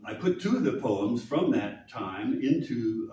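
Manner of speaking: usual speaking voice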